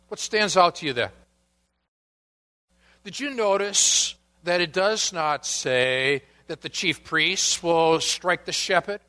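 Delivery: usual speaking voice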